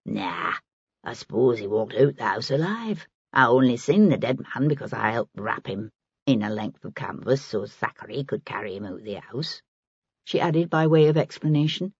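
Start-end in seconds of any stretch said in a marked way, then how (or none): 0.1-9.6 put-on low, soft old woman in dialect; softer, lower dialect voice; lightly voiced Maggie. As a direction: play an earthy working woman, low and casual. Narrator voices Maggie explaining.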